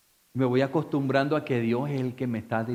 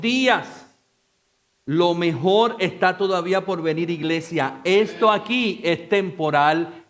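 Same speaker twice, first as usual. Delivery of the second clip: louder and higher, stretched phrases; full-force preaching; 6 semitones higher, 7 dB louder than usual. Acted. Pastor exhorting the church about eternity, full-force preaching. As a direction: proclaim it to the whole church.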